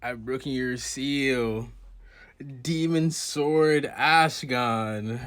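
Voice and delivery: slow, loud, grand anime proclamation. Retold or imitated. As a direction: drag each word, loud and grand. Dramatic sword-naming line.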